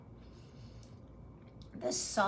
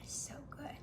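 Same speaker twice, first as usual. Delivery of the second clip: hushed; 7 semitones higher, 10 dB quieter than usual. Real, not acted. Tasting food, hushed reaction.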